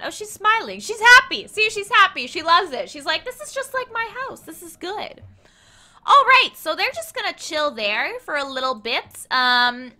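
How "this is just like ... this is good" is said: lightly voiced contented captive, higher and softer; imagined captive's voice. Streamer voices what the captive witch is thinking, small high voice. Voicing a pleased captive's thoughts.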